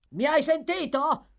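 high, loud demand; loud exasperated holler in a rough character voice; angry shout. A narrator's reading. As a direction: yell at someone who won't wake. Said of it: "urlò la voce di prima".

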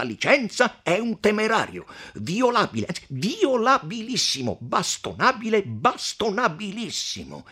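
emphatic declaiming, relishing each superlative; assertive count. Acted. Count declaring the messenger beatable, assertive. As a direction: lay down the law of chivalry.

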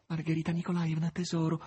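high, imploring maid; pleading. A narrator's reading. Natascia begs Margherita on her knees, high-pitched pleading. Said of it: "prese a dire Natascia in tono supplichevole".

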